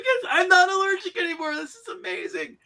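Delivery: laughing. Retold or imitated. GM mocks Ratman's delight, laughing.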